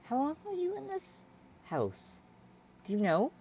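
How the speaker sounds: high, halting questioning; nervous Hyla. Acted. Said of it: "She's petting it. She's like".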